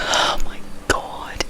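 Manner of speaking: barely audible, drawn-out whisper; hushed, slow exclamation under the breath; appalled whisper. Real, not acted. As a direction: whisper it slowly in disbelief. Appalled aside while recalling the episode.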